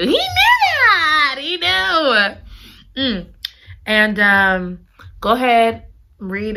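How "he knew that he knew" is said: very high, laughing near-scream; giddy squeal. Real, not acted. Reader gushing that the hero already knew, squealing. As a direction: squeal it with delight.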